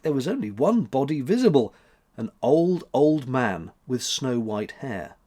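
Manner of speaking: ordinary delivery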